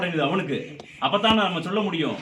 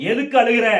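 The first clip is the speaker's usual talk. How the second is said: raised, higher, insistent question; loud demand; 4 semitones higher, 6 dB louder than usual. Real, not acted. Medium demands why the person is crying, loud demand. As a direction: challenge the weeping forcefully.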